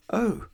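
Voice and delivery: sharp high gasp of horror; horrified gasp. A narrator's reading. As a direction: gasp in shock. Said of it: "she gasped in horror".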